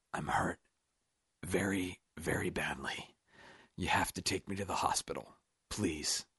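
soft, weak whispered plea. A narrator's reading. Wounded man begs Roop for the hospital, whispering. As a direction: whisper it with failing strength. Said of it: "he whispered".

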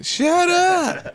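mock scream. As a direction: imitate a woman screaming, pitch shooting up. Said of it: "the women screaming".

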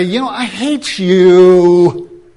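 slow, loud, drawn out; loud hateful declaration. Retold or imitated. Preacher acting out an open hater, slow and loud. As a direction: fling it at them with open contempt. Overt hater says it outright.

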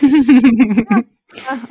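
loud, drawn-out pointed yeah; loud emphatic yeah. Retold or imitated. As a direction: hit it loud and knowing. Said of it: "I was like".

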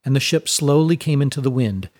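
plain reading voice

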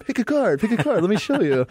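high-pitched, hammed-up magician patter; show-off magician character. Awkward amateur suddenly performing for onlookers, hammed-up show-off. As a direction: snap into pushy performer mode, mocking. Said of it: "they snap into, like, character mode".

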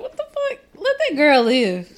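high, incredulous cry of outrage; outraged disbelief. Retold or imitated. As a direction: squeal it like you can't believe it. Outraged reaction to movie scene.

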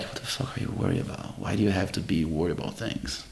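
breathy whispered rebuke to himself; whispered self-talk. Retold or imitated. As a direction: scold yourself under your breath. Inner voice scolding himself.

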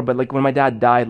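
ordinary delivery

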